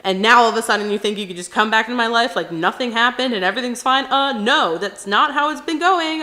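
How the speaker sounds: acted angry, emphatic scolding; mad. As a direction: vent loudly at an ex. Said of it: "I'm mad".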